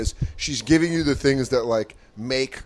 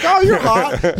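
mock flirty gush; 14 semitones higher, 4 dB louder, much slower than usual. Imitating crowd work comic.

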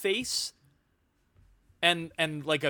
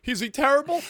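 higher, louder, worried rise; mock anxious question; 8 semitones higher, 6 dB louder than usual. Friend mocking mom's question to the teacher, higher. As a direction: sound dreading the answer. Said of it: "You were like".

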